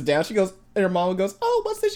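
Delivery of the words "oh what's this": very high, curious mom voice; mimicking a mom's discovery; imitated nosy mom. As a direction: pitch it way up as a prying mother. Voicing mom finding the notebook.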